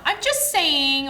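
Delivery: high, drawn-out mock innocence; octave-up fake innocence. Podcaster mimics mothers softening criticism, voice going up high. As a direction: lift the voice high and stretch it. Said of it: "their voice just goes up an octave".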